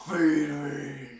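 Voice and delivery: loud, drawn-out wrestler catchphrase; Ryback. Retold or imitated. Podcaster mimicking a wrestler's catchphrase, bellowed impression. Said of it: "Remember the way he said".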